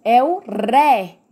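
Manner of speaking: slow answer with a heavy, held "ré"; stressed syllable isolated. Shown as a demonstration. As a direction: lean hard and long on "ré". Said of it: "qual é a sílaba mais forte". ("ré" forte e alongado)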